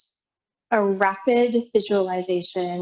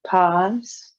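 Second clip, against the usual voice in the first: spare breathing cues with long silences between; guided breathwork; slower than usual. Real, not acted. Yoga teacher counting the class through triangle breath, guided breathwork. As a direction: pace the breath for them, one measured word at a time.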